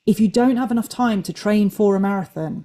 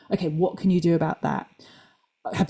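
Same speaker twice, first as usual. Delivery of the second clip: ordinary talk, nothing marked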